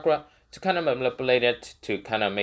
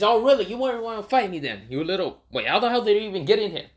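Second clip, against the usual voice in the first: high sneering creature voice, rushing at the end; mocking monster voice; 8 semitones higher, faster than usual. Shukaku sneering at Naruto for coming inside, high. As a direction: scoff at the intruder. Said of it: "he says".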